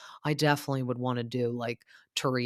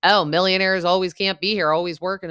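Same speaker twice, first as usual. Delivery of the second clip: louder, sneering mock of Joe; mocking jab; 4 semitones higher, 7 dB louder than usual. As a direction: jeer the absent millionaire. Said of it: "Joe Benigno was like".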